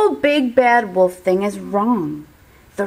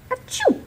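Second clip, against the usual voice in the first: big sneeze. Acted. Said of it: "I sneezed a great sneeze".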